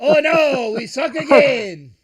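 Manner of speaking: very high, loud, drawn-out comic wail; hammed-up movie-quote impression; Rob Schneider. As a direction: holler it slowly in a put-on accent. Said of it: "You nailed it".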